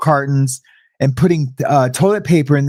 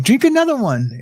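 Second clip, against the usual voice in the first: higher, matter-of-fact cellmate voice; lightly voiced cellmate telling him what to do; imitating the older cellmate's calm command; cellmate's advice; 7 semitones higher than usual. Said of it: "he's like".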